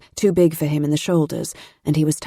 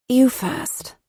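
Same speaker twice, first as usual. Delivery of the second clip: sleepy; 8 semitones higher than usual. A narrator's reading. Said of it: "Matilda said sleepily".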